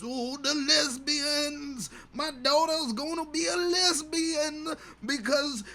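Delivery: very high, slow, strained lament; wailing dad. Acted. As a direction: stretch it out high like a melodramatic wail. Dad wails about daughter.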